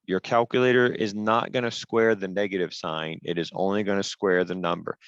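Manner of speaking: normal talking voice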